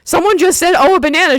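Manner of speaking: high, loud retold disbelief; alarmed insistence. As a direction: imitate him insisting he heard someone. Man repeats his startled claim.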